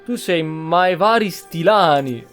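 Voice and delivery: usual speaking voice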